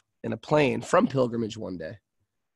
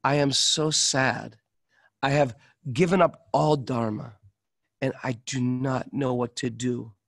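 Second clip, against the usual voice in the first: lament. Retold or imitated. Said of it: "This is real stuff".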